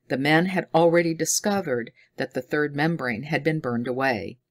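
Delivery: ordinary delivery, nothing marked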